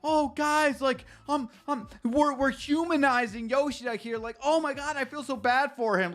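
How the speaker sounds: mock gushing fan. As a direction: coo like an overly sympathetic reader. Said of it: "who read this and is like".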